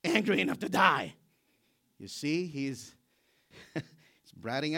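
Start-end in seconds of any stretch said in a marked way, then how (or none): 0.0-1.2 loud, stubborn angry retort; defiant Jonah. Acted. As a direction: dig in and talk back to God. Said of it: "Jonah said".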